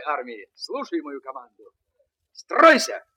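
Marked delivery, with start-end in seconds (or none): 2.3-3.0 high, loud drill command; shouted command. Acted. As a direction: bark the order like a sergeant. Commanding toy soldier march.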